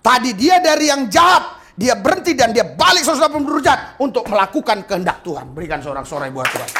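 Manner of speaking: loud, high preaching shout; fiery sermon delivery; full-force preaching. Acted. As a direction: preach it out to the crowd.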